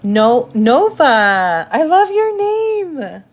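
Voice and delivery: light up at a name you love; high, slow gush of delight at a viewer's name. Real, not acted.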